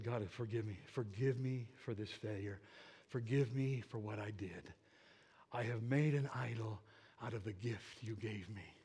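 low, hushed plea for forgiveness; penitent prayer. Retold or imitated. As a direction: softly beg God, without actual tears. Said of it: "I began to cry, I began to weep".

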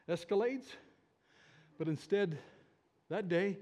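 ordinary talk

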